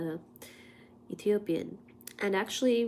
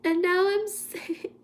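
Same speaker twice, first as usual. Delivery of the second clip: laughing; 10 semitones higher, 4 dB louder than usual. Real, not acted. Laughing at own sickness.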